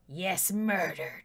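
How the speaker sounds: unvoiced villainous whisper; sinister whisper. Player deciding to murder the friend, sinister whisper. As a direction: breathe it like a plotter.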